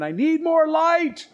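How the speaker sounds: high, loud, drawn-out yell; retold desperate scream. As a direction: scream it out like the dying man. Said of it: "one man was yelling and screaming".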